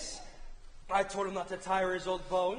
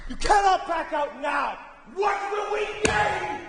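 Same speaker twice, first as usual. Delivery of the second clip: much higher and louder, yelling; furious shout; 8 semitones higher, 9 dB louder than usual. Acted. Brother shouting down the wavering king, furious yell. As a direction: roar it at him.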